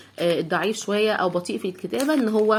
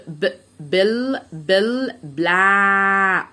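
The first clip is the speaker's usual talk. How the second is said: بل stretched very slowly into بلا; slow sounding out; much slower than usual. Shown as a demonstration. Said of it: "اسود يعني ب". (تهجي بلاك ببطء)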